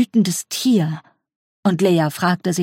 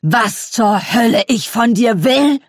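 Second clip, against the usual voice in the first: slow, loud furious shout, voice pitched up; 4 semitones higher, 4 dB louder, slower than usual. Acted. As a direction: roar it at her across the table. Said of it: "Dann schrie er".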